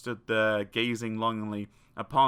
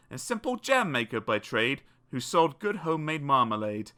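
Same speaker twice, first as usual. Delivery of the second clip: usual speaking voice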